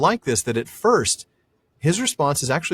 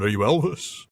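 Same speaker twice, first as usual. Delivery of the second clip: light [name] voice; 4 dB quieter than usual. Pretend. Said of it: "I will never do an [name] impression again".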